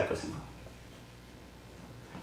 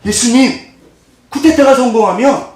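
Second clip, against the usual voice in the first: acted eager plea, higher and louder; 8 semitones higher, 5 dB louder than usual. Aunt asking Jesus for her sons' seats, eager acted plea. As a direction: plead up high and louder, lightly playing the petitioner.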